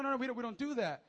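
ordinary talk

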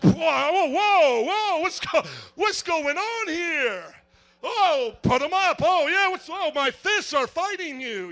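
loud, high whoas of pretend shock; hammed-up innocent astonishment; mock bewilderment. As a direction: play the helpless victim, loudly startled. Mocks people who fell into temptation.

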